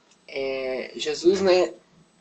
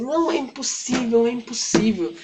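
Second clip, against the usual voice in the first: higher-pitched mock protest; acted protest; 7 semitones higher than usual. People telling Jairo it cannot be done, acted protest. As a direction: insist in a raised, acted voice.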